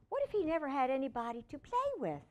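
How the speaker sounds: usual speaking voice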